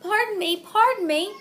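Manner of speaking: mild mock bump and polite pardon; bumping apology. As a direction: blurt it out as they collide. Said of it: "they both said as they bumped".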